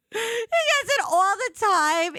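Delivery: high, loud laugh through words. Real, not acted. Telling the listeners about her friend, laughing hard.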